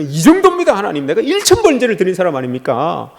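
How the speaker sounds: high, insistent boasting to God; showing oneself off. Acted. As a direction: parade your achievements, pitch leaping up. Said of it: "나를 드러낼 때는".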